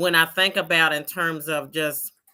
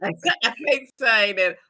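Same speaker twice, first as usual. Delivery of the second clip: louder, words breaking into laughter; can't get through it for laughing; laughing while talking; 5 semitones higher, 7 dB louder than usual. Real, not acted. Cracking up mid-sentence.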